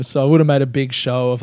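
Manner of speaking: ordinary talk, nothing marked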